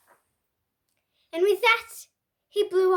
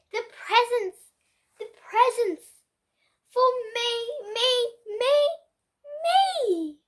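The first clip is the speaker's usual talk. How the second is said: higher, louder chant of me me me; greedy glee; 6 semitones higher, 4 dB louder than usual. A narrator's reading. Pig chortling over his presents, greedy glee. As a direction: crow it like a spoiled child.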